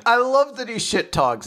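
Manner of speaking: ordinary delivery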